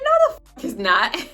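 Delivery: loud, disbelieving outburst; shocked denial. Retold or imitated. Told by her boyfriend about flyers, a loud shocked denial. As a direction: blurt it out, caught off guard.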